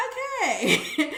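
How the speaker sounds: real amused laugh. Real, not acted. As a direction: giggle through it. Laughing after the joke.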